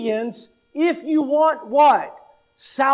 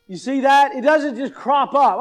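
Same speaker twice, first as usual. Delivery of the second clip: ordinary delivery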